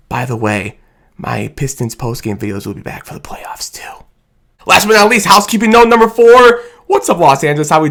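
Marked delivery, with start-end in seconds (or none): 0.1-4.0 soft whispered aside. Real, not acted. Fan vlogger teasing his viewers with a whispered aside. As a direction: let them in on a secret in a whisper.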